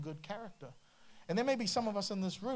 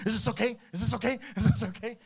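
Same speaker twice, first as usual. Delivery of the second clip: loud, repeated, nagging asking; pestering friend; 5 semitones higher, 7 dB louder, faster than usual. Retold or imitated. Intrusive friend badgering at a bad time, nagging. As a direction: pester them over and over. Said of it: "come at an inconvenient time and say".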